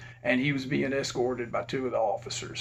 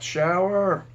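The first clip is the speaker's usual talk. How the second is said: higher, louder imitation of a woman calling; hollering female voice; 5 semitones higher, 5 dB louder than usual. Inmate calling to a friend upstairs, hollering in a female voice. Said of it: "this female voice holler out".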